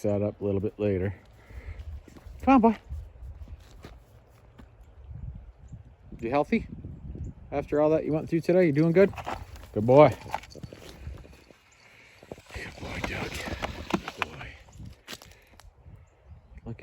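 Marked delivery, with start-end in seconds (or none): 2.4-10.1 high, loud gushing pet voice. Real, not acted. Owner praising Doug after he handled things, high loud pet voice. Fussing over the dog Doug. 12.5-14.5 soft breathy whisper to the dog; whispered praise. Real, not acted. Owner softly praising Doug, whispered.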